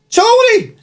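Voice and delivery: high, loud Scouse holler; imitated shout. Counter girl to the frying guy, high acted shout. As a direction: yell shrilly for the man at the back. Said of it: "And she shouted".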